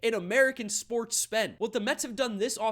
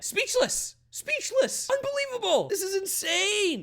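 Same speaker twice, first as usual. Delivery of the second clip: much higher, almost squealing in disbelief; overwhelmed; 12 semitones higher, 3 dB louder, slower than usual. Real, not acted. Fan hearing Correa signed, squealing in disbelief. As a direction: squeal it out, beside yourself.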